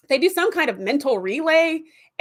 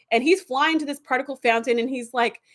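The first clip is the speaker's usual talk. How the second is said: normal talking voice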